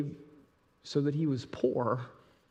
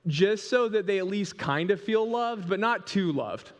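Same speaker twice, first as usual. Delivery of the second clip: mock stingy parent; 6 semitones higher than usual. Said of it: "You're like".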